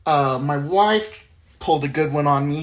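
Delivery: usual speaking voice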